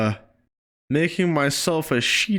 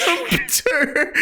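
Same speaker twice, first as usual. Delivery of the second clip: mock echo; 10 semitones higher than usual. Echoing the funny name.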